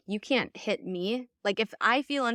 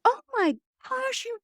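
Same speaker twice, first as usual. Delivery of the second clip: mock shocked gasp; 3 dB louder than usual. Mom imagined gasping at daughter's news, high mock shock. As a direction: play the horrified mom.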